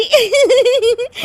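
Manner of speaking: high-pitched rising giggle. Acted. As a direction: burst into a shrill giggle fit. Bahu laughing fit.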